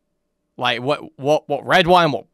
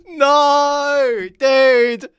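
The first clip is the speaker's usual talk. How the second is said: laughing shout; 8 semitones higher than usual. Real, not acted. Laughing outburst at the story.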